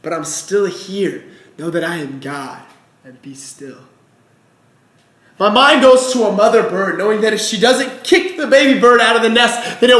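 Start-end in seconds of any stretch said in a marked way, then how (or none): 0.0-3.8 low, soft and hushed, God answering gently; God's quiet answer. Acted. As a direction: speak it low and quiet, a calm divine reply.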